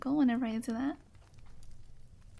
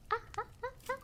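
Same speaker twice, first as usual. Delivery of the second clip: high, soft imitation laugh; evenly chopped put-on giggle; Pekora laugh; 11 semitones higher, 8 dB quieter than usual. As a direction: mimic a staccato cartoonish laugh. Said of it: "and my Pecora imitation".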